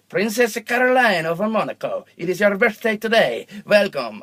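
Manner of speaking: high, loud mock-Italian greeting; Italian accent. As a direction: put on a big singsong Italian accent. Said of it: "I was doing an Italian accent".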